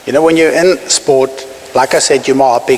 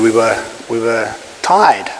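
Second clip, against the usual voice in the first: ordinary talk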